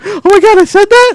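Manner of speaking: hammed-up gasp of disbelief; mock shock. Cohost hearing her own clip, high mock shock. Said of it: "she was like".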